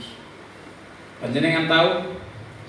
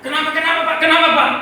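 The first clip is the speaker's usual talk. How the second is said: high, urgent calls, getting louder; startled questioning; 13 semitones higher, 8 dB louder, faster than usual. Retold or imitated. Startled room asking the shouting father, alarmed questioning. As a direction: ask in alarm, repeating it. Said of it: "Semua ruangan itu kaget".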